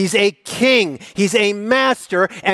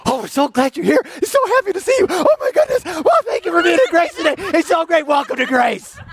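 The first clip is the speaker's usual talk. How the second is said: high, loud, gushing welcome; puppy-like greeter; 11 semitones higher, 4 dB louder, faster than usual. Pretend. Church greeter welcoming visitors like puppy, high gushing. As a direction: gush over newcomers like a puppy. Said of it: "we should all just be puppies at the door".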